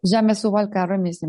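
ordinary talk

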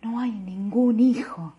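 slow whispered denial; hushed flat denial. Acted. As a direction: whisper it, emptied out. Woman denying, whispered.

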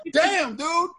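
mock amazed American dude; mock impressed American bro. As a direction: ham up a wowed American guy. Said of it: "and then they'd be like".

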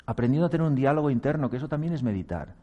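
ordinary talk

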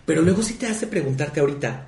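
ordinary delivery

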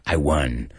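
low, self-satisfied little chuckle and boast; smug smirk. Shown as a demonstration. Teacher demonstrating a smirk, smug and low. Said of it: "con superioridad. Con satisfacción. Like".

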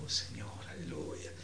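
hushed, mock-weepy praise; retold tearful prayer. Preacher recalling a worshipper once moved by music, tearful and hushed. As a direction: softly imitate someone moved to tears in prayer. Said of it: "yo estaba llorando".

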